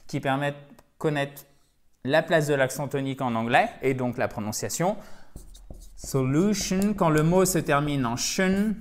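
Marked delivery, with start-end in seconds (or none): 6.0-6.9 "Solution" with a higher pitch and weight on the middle syllable; stress on second syllable. Shown as a demonstration. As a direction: punch the LU in "Solution", before the final shun. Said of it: "l'accent tonique sur la syllabe précédente". (accent tonique sur l'avant-dernière syllabe de « Solution »)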